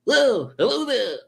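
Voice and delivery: very high hooting greeting at normal volume; Rob. Acted. Repeating Rob's greeting like he does, high impression held back. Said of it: "Like he does".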